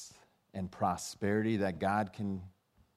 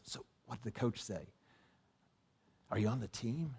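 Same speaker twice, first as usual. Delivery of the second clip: whispered in class; 3 semitones higher, 6 dB quieter than usual. Retold or imitated. Buddy leans over in class.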